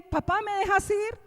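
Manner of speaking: put-on high, hopeful child asking papá; pleading child. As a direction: beg like a little boy for permission. Voicing son asking father.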